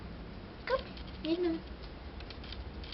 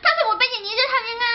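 flustered; 9 semitones higher, 6 dB louder than usual. Real, not acted. Panicking over the smudge.

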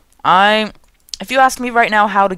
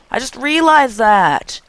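higher, slow dramatic realization; odd tone; 6 semitones higher, slower than usual. Real, not acted. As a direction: say it in a strange drawn-out high tone. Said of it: "I don't know why I'm talking in this tone".

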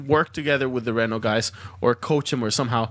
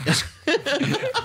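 high yelping seal bark; seal bark impression; 14 semitones higher than usual. Shown as a demonstration. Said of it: "That was my best impression of a seal".

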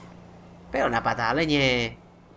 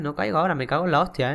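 ordinary delivery

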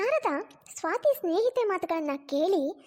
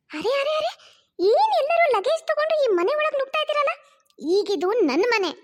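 higher, louder outraged daughter; indignant daughter; 8 semitones higher, 5 dB louder than usual. Acted. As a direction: stop them at the door, outraged. Daughter blocks family at door.

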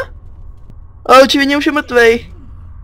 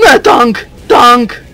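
alarmed warning; 2 semitones lower, 5 dB louder, much slower than usual. Real, not acted. Warning team of a tank.

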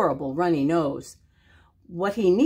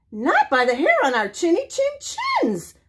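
much higher squeaky pig voices; squealing pigs; 10 semitones higher than usual. Acted. Two little pigs refuse the wolf, squealing high. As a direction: squeal it defiantly. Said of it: "squealed the two little pigs".

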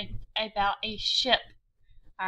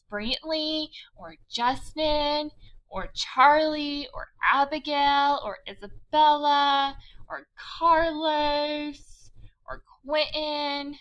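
drawn-out names; 3 semitones higher, slower than usual. Shown as a demonstration. Listing names slowly to class.

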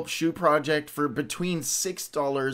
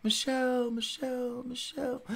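high sing-song call that fades softer and softer; calling out, fading away; 10 semitones higher, 5 dB quieter than usual. Pretend. Host calls to a friend, high call fading. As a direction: call her name, drifting off into the distance.

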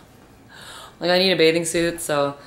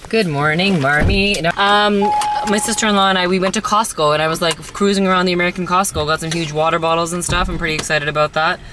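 excited; 4 semitones higher, 7 dB louder than usual. Real, not acted. Excited vlog greeting, loud throughout.

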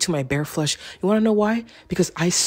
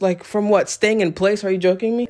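higher-pitched, winded complaint; out of breath; 6 semitones higher than usual. Real, not acted. Vlogger baffled at being winded from staying in place, out of breath. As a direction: complain while catching your breath. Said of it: "I'm, like, literally out of breath".

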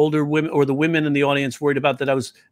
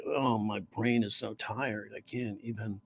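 slow, soft, lower and worn out; tired deadpan; 4 semitones lower, 14 dB quieter, slower than usual. Retold or imitated. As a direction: sound utterly drained and sleepy. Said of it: "his nuance of being almost like".

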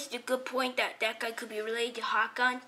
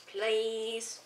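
ordinary delivery

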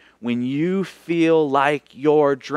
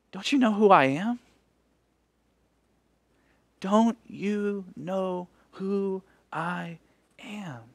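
gentle question; 3 semitones higher, 6 dB quieter, slower than usual. Acted.

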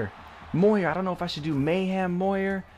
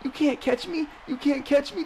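gleeful taunting; 12 semitones higher than usual. Real, not acted. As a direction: crow at the defenders who can't keep up. Breaking tackles, taunting defenders.

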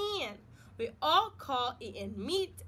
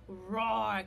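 dinosaur roar; 6 semitones lower, 4 dB louder than usual. Pretend. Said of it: "this one's saying".

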